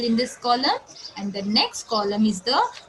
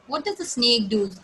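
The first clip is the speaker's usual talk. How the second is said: normal talking voice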